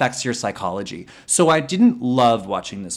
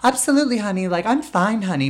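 high, sing-out housewife cheer over hidden upset; chirpy, much higher mock housewife reassurance; fifties housewife voice; 10 semitones higher than usual. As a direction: put on a high-pitched 1950s housewife. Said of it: "nineteen fifties housewife voice".

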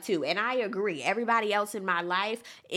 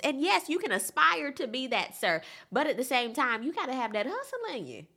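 ordinary delivery

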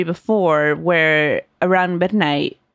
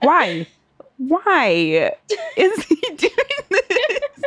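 drawn-out mock-freaked wail, climbing high; mock distress; 12 semitones higher, much slower than usual. Reacting to a child's scary story voice, mock distress. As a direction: plead in hammed-up dismay, breaking into laughter. Retells her scared bafflement, laughing.

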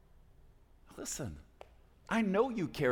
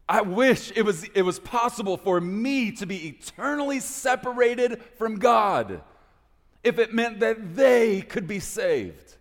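much louder and higher, pleading from the gut; impassioned loud plea; 9 semitones higher, 7 dB louder than usual. Acted. Preacher voicing Paul's wish for his people, impassioned loud plea. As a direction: pour it out like Paul's heartbroken wish.

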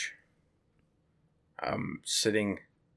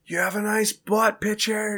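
much higher, lightly called-out cheer; mock yell from the stands; 12 semitones higher, 3 dB louder than usual. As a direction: girls calling out from the bleachers. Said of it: "girls would be in the stands yelling out".

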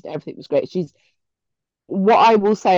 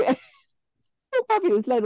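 high voice breaking into laughter; laughing; 13 semitones higher, much slower than usual. Real, not acted. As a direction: try to talk through a laugh. Laughing while telling friend's fall.

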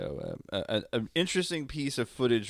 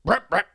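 gunshot noises; 9 dB louder than usual. Retold or imitated.

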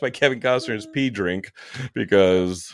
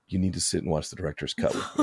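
mock threat; 5 semitones lower, 9 dB quieter, faster than usual. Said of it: "and they're like".

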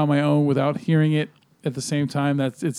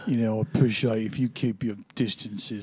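put-on low, soft English accent; deep English accent; 6 semitones lower, 6 dB quieter than usual. Showing the accent practised while driving, deep English voice. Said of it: "English accent".

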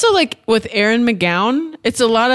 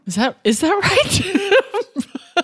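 laughing question; 10 semitones higher, much slower than usual. Real, not acted. Laughing at own word mix-up.

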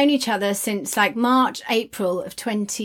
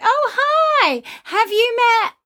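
mock bright greeting; 11 semitones higher, 4 dB louder, slower than usual. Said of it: "I would just be like".

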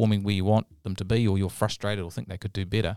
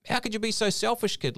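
high, indignant retold scolding; mock furious; 12 semitones higher than usual. Dad scolding his son, mildly acted high-pitched indignation. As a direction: play a fed-up dad without shouting.

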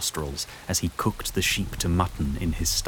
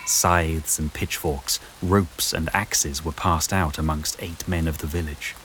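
ordinary delivery

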